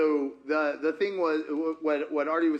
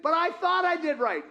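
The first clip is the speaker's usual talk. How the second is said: whiny protest; 11 semitones higher than usual. Retold or imitated.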